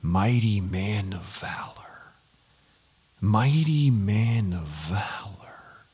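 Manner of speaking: slow, low whisper; echoing memory. Acted. Words ringing in Gideon's ears years afterwards, slow hushed echo. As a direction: whisper it drawn out, like words lingering. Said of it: "those words just rang in Gideon's ears".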